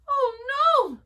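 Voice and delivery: high, loud alarmed "oh no"; dismayed gasp. A narrator's reading. Mama Bear finds the door open, high and alarmed. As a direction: sound the alarm as a startled mother. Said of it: "said Mama Bear".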